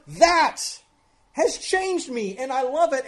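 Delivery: high, loud, fervent declaration; impassioned testimony. Acted. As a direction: build it up with exulting fervour, pitched high. Voices a changed believer's fervour.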